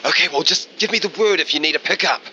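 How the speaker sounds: high, quick radio voice; Trevor. Acted. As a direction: offer help breezily over the radio. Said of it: "you hear over the radio from Trevor".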